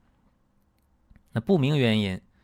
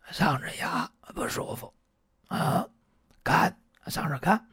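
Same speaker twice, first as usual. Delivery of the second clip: 嗓子哑 whispered, rasping and voiceless; 嗓子哑 in a husky, voiceless patient whisper; hoarse patient; 8 semitones higher than usual. Retold or imitated. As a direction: imitate a patient who has lost his voice.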